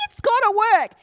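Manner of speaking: high, loud retold protest; worked-up, determined outburst; insistent. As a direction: insist against the evidence. Retells her insistence.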